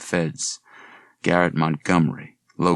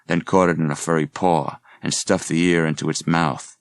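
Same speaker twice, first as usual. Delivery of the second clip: ordinary delivery, nothing marked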